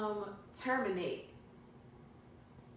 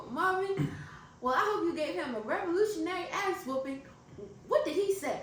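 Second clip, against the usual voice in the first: higher, hammed-up old country lady, steadier take; playing Harriet again; 7 semitones higher than usual. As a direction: deliver the line cleanly as the feisty aunt. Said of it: "Just make yourself a character".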